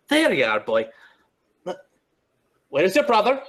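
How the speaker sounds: high, loud calling out to the treetops; rising shouts to a bird in the canopy; Nyctis calling his birds. Acted. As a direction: call up into the trees for your pet. Nyctis calls his pet birds.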